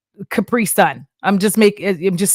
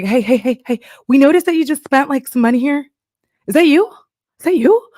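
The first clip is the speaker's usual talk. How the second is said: mock nosy bank; 6 semitones higher, faster than usual. Bank texts about charges, mock voice.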